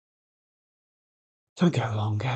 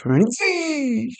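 excited call; 5 dB louder than usual. Real, not acted. Cheering a player on.

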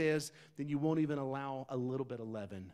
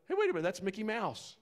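sudden surprise; 7 semitones higher, 6 dB louder, faster than usual. Retold or imitated. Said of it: "he looks over and he's like".

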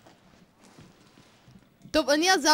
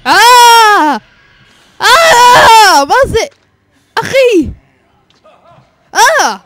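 high, very loud shrieks of shock; yelling in alarm at a shocking sight; startled screaming; 11 semitones higher, 17 dB louder, much slower than usual. Real, not acted. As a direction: scream out in horrified disbelief. Jump scare in horror game.

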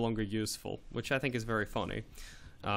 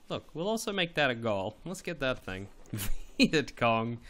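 normal talking voice

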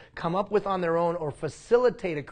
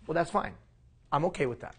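ordinary delivery

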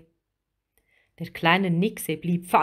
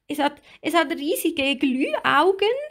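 high, agitated little mermaid; alarmed high-pitched exclamation; crying out; 8 semitones higher than usual. A narrator's reading. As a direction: stumble into it in a small high voice. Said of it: "rief Lilli".

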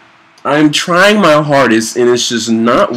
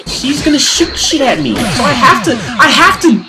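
panicked; 14 semitones higher than usual. Real, not acted. Panicked boss fight.

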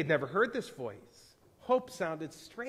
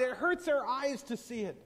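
high-pitched complaint; pained cry; 7 semitones higher than usual. A narrator's reading. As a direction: protest in a raised, distressed pitch. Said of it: "they cried".

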